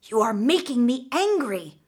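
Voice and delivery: usual speaking voice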